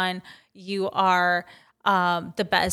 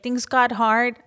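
ordinary delivery

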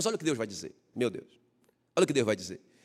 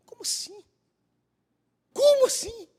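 mock disbelief. Mock disbelief after God's answer.

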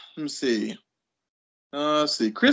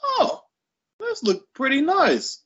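put-on intrigued kid's reaction; 11 semitones higher, slower than usual. Gamer recalls first seeing Super Mario, intrigued. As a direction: quote your younger self, lightly wowed and high-pitched. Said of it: "and I was like".

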